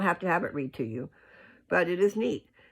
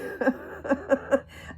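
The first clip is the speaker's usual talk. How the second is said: breathy laugh; 11 semitones higher, 4 dB louder than usual. Real, not acted. Laughing about YouTube lasting.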